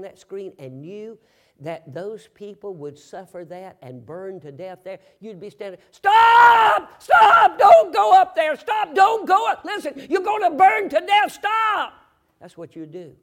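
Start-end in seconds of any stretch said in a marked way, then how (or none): 5.9-11.9 high, very loud screamed warning; frantic warning shout. Acted. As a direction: yell desperately to stop them from burning to death. Acts out warning people away from fire.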